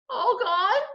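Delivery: put-on high, small child's question; little toddler. A narrator's reading. Hurt little brother asking about his teeth, childlike.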